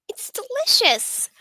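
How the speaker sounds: put-on high, slow, sweet girl's voice; cheery girl voice. Schoolgirl praising a friend's cupcakes, high and slow. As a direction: savour it like a delighted schoolgirl. Character voiced reading visual novel.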